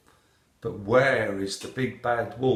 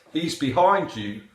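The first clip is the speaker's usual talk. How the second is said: raised voice, lightly acted; mild warning cry; 5 semitones higher, 7 dB louder than usual. A narrator's reading. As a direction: call out a warning as the parrot. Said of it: "cried the parrot".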